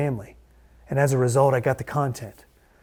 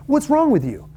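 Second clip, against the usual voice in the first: much higher, louder, incredulous wife; exasperated wife; 13 semitones higher, 4 dB louder than usual. Retold or imitated. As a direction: throw the question up in disbelief. Wife's exasperated climax.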